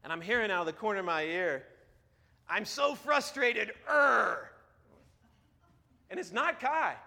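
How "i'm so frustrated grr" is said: drawn-out cartoon growl of frustration; frustrated Daniel Tiger. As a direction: whine it out slowly, then growl loud. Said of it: "It's Daniel Tiger".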